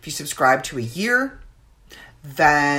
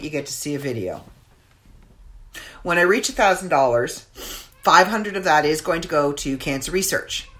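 normal talking voice

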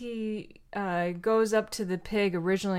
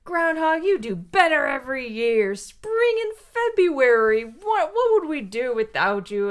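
louder, fawning praise with a high peak; bear praising; 5 semitones higher, 5 dB louder than usual. Retold or imitated. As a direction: gush over the groundhog like a grateful bear.

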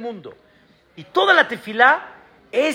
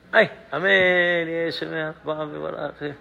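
lazy mumbled amen; 6 semitones lower, 5 dB quieter than usual. Retold or imitated. Imitates lazy bored amen.

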